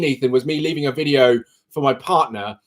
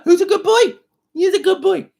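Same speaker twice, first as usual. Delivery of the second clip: much higher squeaky coo. Real, not acted. Squeaky high coo praising the kitten on his lap.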